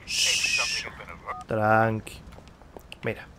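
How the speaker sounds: soft shush then a low tranqui; hushing. Real, not acted. Gamer quieting his character Bobby, soft hush. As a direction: hush him down gently.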